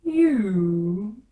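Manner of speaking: loud, scornful single word; loud, scornful caterpillar; contemptuous. A narrator's reading. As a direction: sneer the word at her. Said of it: "said the caterpillar contemptuously".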